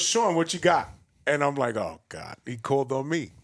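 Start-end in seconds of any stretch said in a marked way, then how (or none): 0.0-0.9 loud, higher retold host's call; raised voice imitating a seminar host; calling on someone. Host calls on him, retold. 1.8-2.4 low, sinking mock dismay; a dropped-pitch "oh no" reaction; retold dread. His dismay at being called on.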